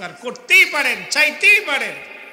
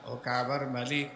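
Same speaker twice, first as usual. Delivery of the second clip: pleading prayer; 4 semitones lower than usual. Acted. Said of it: "আসামির মতো হয়ে আল্লাহর কাছে মাপ চাইতে হবে". (নরম নিচু গলায় কাতর মোনাজাত)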